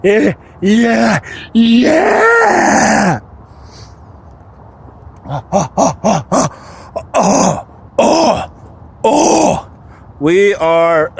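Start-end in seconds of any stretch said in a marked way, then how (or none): 0.0-3.2 free yell. Real, not acted. Yelling freely in the woods. 5.3-9.7 wild oh sounds. Real, not acted. Vocalizing in the woods.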